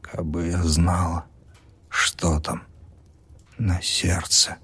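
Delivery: soft, slow whispered sorrow; patient sadly whispering. A narrator's reading. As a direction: let it fade like a sigh. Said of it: "невесело улыбнулась".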